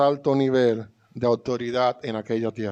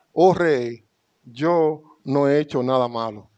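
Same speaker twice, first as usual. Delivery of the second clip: scripture proclaimed; 3 semitones higher, 5 dB louder than usual. Acted. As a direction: proclaim Daniel's answer to the king. Daniel habla desde el foso. (lectura proclamada de la respuesta de Daniel)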